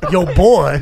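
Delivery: hammed-up Southern taunt, booming. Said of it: "Total redneck shit".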